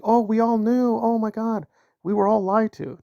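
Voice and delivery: mimicking people pretending they never knew; mocking quote. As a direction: parody their fake shock. Mocking people revising history.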